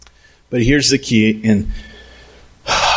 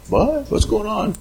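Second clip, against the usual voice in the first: high, softer mock-innocent question; oblivious listener; 6 semitones higher, 4 dB quieter than usual. Person warned by pastor, oblivious high-pitched question. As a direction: play the clueless one being warned. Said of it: "They're like".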